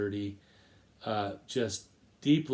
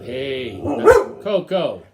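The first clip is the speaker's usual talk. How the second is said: high, loud call across the room, slowed; sudden high, loud call to a pet, then back to the talk; calling out to the dog; 12 semitones higher, 13 dB louder, slower than usual. Real, not acted. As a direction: break off to call the dog by name.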